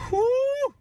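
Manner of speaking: breathy laugh into a high loud whoo; whooping laugh. Real, not acted. Laughing at her own revenge, a high whoop. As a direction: let the laugh burst into a whoop.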